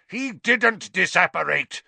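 high-pitched, forceful outburst; furious roar. A narrator's reading. Snape contradicts Fudge close at hand, roaring. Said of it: "Snape roared".

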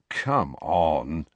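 low, deflated dismissal; hopeless. A narrator's reading. Harry doubting Ginny can help, hopeless. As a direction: drop the pitch as if giving up. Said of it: "said Harry hopelessly".

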